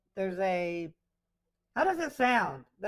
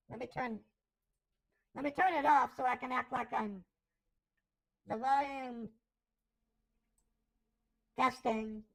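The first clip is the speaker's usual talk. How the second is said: ordinary talk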